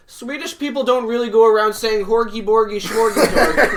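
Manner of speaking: Swedish Chef. Acted. Podcaster mimicking a puppet chef for laughs, loud mock-Swedish voice. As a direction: launch into a loud mock-Swedish gibberish bit. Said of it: "like, the Swedish chef".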